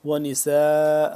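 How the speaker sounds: ونساء with the سا held four to five beats; long madd. Shown as a demonstration. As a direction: stretch the vowel of ونساء very long. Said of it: "چار سے پانچ الف کے برابر لمبا ہے".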